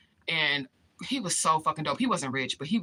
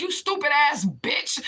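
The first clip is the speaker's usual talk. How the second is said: high, drawn-out angry insult; slow, pitched-up berating; retold going off; 9 semitones higher, 4 dB louder, slower than usual. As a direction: imitate a man blowing up at his baby mama. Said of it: "he was going off on his baby mama".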